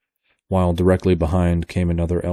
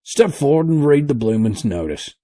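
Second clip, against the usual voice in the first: high, louder rough seaman order; rough sailor; 8 semitones higher, 5 dB louder than usual. A narrator's reading. Sailor ordering the professor to read. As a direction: bark it at the old man.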